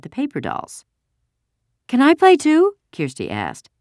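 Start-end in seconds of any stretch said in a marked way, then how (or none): 1.9-2.7 put-on very high, loud child's voice; childish request, pitched way up; eager little girl. A narrator's reading. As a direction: pipe up like a kid wanting to join in. Small girl asks to join.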